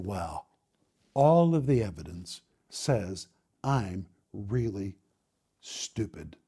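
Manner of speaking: low, soft, slow sinking realization; crushed, defeated. Retold or imitated. Ninth grader absorbing the counselors' verdict, crushed and defeated. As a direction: let each word drop as it sinks in.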